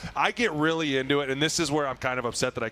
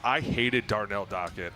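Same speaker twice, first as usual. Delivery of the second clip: usual speaking voice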